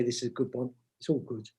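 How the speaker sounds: a soft, offhand understatement; mock modest. Author teasing his own book, mock modest and quiet. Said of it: "He said modestly".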